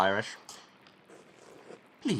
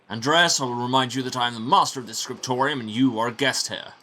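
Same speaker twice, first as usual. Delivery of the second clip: louder, firm lordly prior; stern prior; 4 semitones higher, 7 dB louder than usual. Acted. Prior reminding guest Andreas who is master here, firm. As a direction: put the guest in his place.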